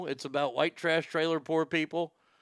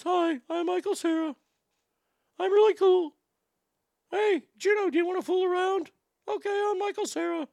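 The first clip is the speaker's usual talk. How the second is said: much higher, mocking nasal-free boyish voice of Michael Cera; Michael Cera impression. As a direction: play the awkward boy, pitched way up to mock him. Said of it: "he likes to talk like this".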